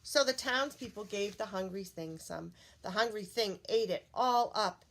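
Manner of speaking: plain reading voice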